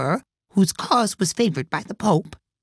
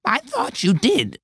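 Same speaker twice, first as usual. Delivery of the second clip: frowning but polite; 11 semitones higher than usual. A narrator's reading. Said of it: "frowning, but very politely".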